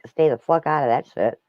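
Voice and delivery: usual speaking voice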